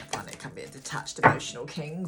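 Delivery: half-mysterious, half-mocking reading of the card; odd put-on voice. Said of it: "I don't know why I'm talking like that".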